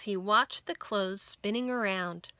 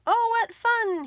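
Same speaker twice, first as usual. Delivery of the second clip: high, bright burst of delight, louder; delighted, smiling; 13 semitones higher, 7 dB louder than usual. Acted. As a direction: squeal with joy sliding in the soap. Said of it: "he said with a smile".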